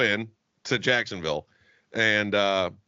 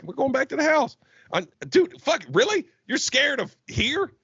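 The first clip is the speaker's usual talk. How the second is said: incredulous rant; 10 semitones higher than usual. Retold or imitated. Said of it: "I'm like".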